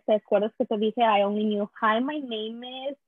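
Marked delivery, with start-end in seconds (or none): 1.8-3.0 put-on slow, halting English with a thick Spanish accent; careful newcomer English, heavily Spanish-accented; beginner's Spanish-accented English. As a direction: imitate a child's rehearsed English in a Spanish accent. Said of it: "Y yo con lo poquito inglés".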